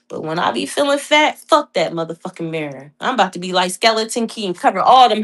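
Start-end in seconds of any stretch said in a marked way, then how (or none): none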